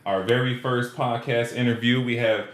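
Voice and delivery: drawn-out high announcer build-up; announcer introduction. Acted. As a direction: bring the guest on like an MC. Introducing the guest.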